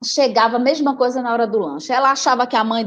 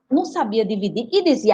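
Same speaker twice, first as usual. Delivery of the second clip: usual speaking voice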